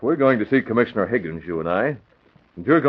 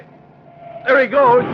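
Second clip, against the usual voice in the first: excited shout; 7 dB louder, much slower than usual. Acted. Car swerves off the road.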